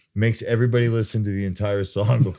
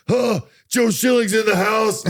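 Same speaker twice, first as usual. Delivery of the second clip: much higher, slow, louder showman voice; mock announcer call-out; 14 semitones higher, 4 dB louder, slower than usual. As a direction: announce him like a ring MC. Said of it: "he'll be like".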